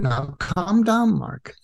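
slowed, drawn-out calm down; slow soothing. Retold or imitated. Driver calming the frantic guest, slow and soothing. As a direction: ease him down gently. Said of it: "what I was telling him".

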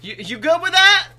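high, loud mock holler; calling out. Stranger checks on a driver's tire, called out loudly. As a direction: imitate a stranger yelling over from his car.

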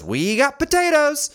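proud produce guy. Retold or imitated. Produce guy answering the shopper, slow and loud. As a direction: proclaim it like store news. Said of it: "And he goes".

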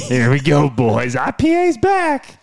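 drawn out, very high and slow, cheering; hype announcement. Real, not acted. Host cheering the guest's comeback to the boys, hyped. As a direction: call it like an announcer welcoming a champion. Hosts hyping the guest's return.

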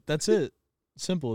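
normal talking voice

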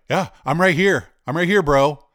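mimicking the stranger's smug taunt; taunting challenge. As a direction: throw the dare back at him, mock-tough. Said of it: "he goes, he's like".

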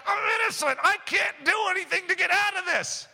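high-pitched mock helpless protest; hammed-up helpless whine; whining excuse-maker. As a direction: plead innocence in a put-on, high voice. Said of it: "you're like".

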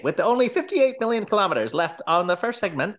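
high, excited race announcer voice; race caller. Retold or imitated. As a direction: announce the race like a radio play. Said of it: "it was very much like that and almost like a radio play".

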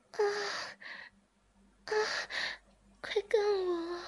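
narrated woman moaning and protesting. A narrator's reading. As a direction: protest then give in, moaning. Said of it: "志明摇着头说".

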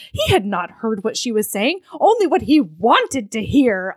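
swooping, emphatic romance narration; dramatic novel reading. A narrator's reading. Reading the heroine's realization to her co-host, dramatic and emphatic. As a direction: read it with big theatrical swells.

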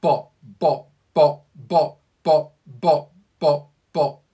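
loud, punchy staccato "Bop, bop" chant; full-voiced warm-up exercise, short and loud; vocal warm-up bops. Shown as a demonstration. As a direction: bark out each "bop" like a drill. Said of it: "He'll do something like this".

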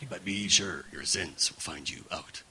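low, solemn doom voice; ominous plaque voice. Retold or imitated. Preacher repeating the plaque in its low ominous tone. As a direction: read it as a looming threat. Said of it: "I was always in that tone".

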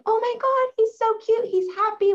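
put-on very high-pitched gushing over a baby; squeaky-high put-on baby-talk delight; mock cooing. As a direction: imitate an adoring adult cooing at a baby. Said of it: "if a baby's smiling, we're like".

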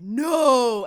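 loud, drawn-out shouted no; angry refusal. Retold or imitated. Mean guy refusing his friend's plea, loud defiant shout.

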